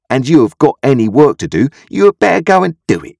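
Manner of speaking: high, loud, bossy sister; scolding sister. A narrator's reading. Sister scolds Joe in the story.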